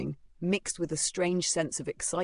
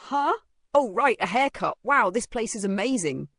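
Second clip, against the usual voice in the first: flustered stammer; 6 semitones higher, 6 dB louder than usual. Acted. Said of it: "he stammered".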